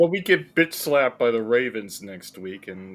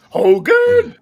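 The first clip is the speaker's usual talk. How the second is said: loud, very high drawn-out cry; hammed-up exasperated bellow of a name; mock commandant yell; 10 dB louder than usual. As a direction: call out like an outraged officer. Calls out as the TV commandant.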